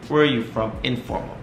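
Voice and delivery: ordinary delivery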